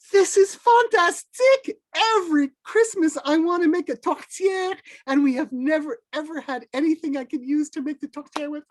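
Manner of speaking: high, gushing thrilled voice; overjoyed, almost squealing; wife thanking Tijan for the pig, overjoyed; delighted wife. Acted. Bertha thrilled about the pig.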